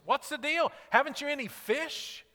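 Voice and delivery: surprised. Retold or imitated. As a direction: sound genuinely amazed they caught nothing. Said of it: "registers this surprise in his voice".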